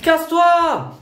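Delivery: real screamed order; furious shout. Real, not acted. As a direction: throw the order in her face. Yelling at mother.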